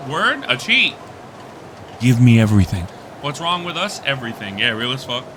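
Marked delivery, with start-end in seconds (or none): 0.1-2.8 excited. Real, not acted. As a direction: pounce on the cheat. Excited reaction to cheat.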